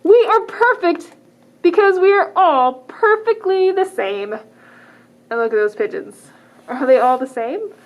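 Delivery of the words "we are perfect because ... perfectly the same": higher, louder, slow and proud pigeon chorus; proud pigeon flock. Acted. Librarian reading the pigeon flock to kids, higher, louder and proud. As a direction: puff up like a smug flock of pigeons.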